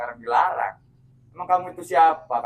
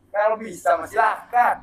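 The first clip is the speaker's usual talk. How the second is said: louder, higher defiant dare; defiant challenge; 6 semitones higher, 3 dB louder than usual. Real, not acted. Preman dares the man threatening his legs, defiant. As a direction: throw the threat back, raised voice.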